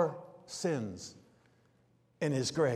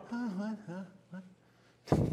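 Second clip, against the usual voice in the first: mock-puzzled huh and what, softer; confused listener; 3 semitones higher, 9 dB quieter than usual. Preacher mocking a listener who misses the crescendo, baffled. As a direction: play someone lost and baffled. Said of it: "and you're going".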